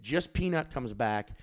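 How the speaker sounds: ordinary delivery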